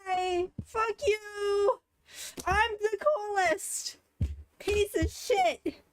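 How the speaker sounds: shrieking high with joy, half crying; ecstatic shrieks. Real, not acted. Streamer celebrating a lucky pull, ecstatic shrieking. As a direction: scream out the victory, slow and stretched.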